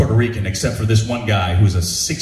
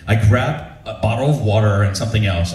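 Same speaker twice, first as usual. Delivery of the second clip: ordinary delivery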